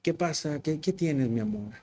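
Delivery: softly imitating a husband asking gently; a mild, soft, tender question to his wife; caring husband. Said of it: "Llegamos de la casa y, like". (esposo preguntando suavemente, con ternura)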